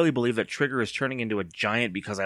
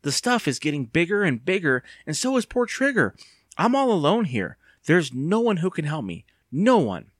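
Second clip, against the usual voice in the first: high-pitched, despairing complaint; wailing; 7 semitones higher, 3 dB louder than usual. A narrator's reading. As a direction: wail in helpless panic. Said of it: "Evan wailed".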